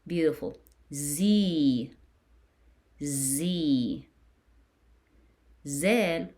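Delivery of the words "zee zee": "Z" as a drawn-out zee; "Z" stretched into a long zee; long E syllable. Shown as a demonstration. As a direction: hold the ee in "Z" for pupils to copy. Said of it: "you will add E to it".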